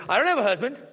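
coy, a little showy; hint of a flirty woman. Woman flirts she's available.

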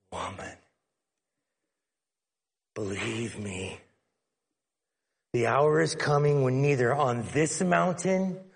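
tender, earnest assurance; Jesus' gentle voice. Retold or imitated. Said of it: "where I hear Jesus' voice go into".

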